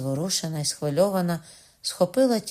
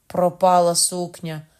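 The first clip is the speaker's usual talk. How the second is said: louder, slow lament over the dress; groaning; 6 dB louder, slower than usual. Acted. Governess groans that the dress is ruined, slow and louder. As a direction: wail the loss, slow. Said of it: "стогнала вона".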